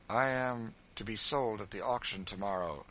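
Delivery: plain reading voice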